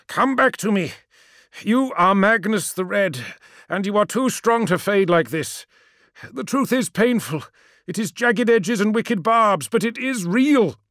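high, urgent appeal; rally a failing father with feeling; earnest, pleading insistence; impassioned plea. Acted. Ammon pleads with broken father.

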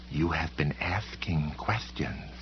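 soft, slow, drawn-out warning; hushed, deliberate menace, every word stretched; menacing policeman. A narrator's reading. Library policeman warning Sam off his questions, slow quiet menace.

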